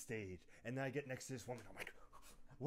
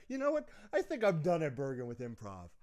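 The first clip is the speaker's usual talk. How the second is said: high excited burst, loud; mock declaration; 4 semitones higher, 8 dB louder than usual. Telling the professor he's done, mock grand. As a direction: announce it like a big decision. Excited retelling of quitting improv.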